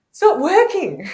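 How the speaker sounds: high, loud cry of frustration; a hammed-up plea that it isn't working; mock exasperated complaint. She complains to the shaman, high and exasperated.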